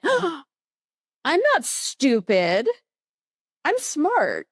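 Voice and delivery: high, drawn-out indignant retort; acted self-defence. Answering the voice that called them stupid, indignant. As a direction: stand up for yourself, slowly and high. Answers back to inner critic.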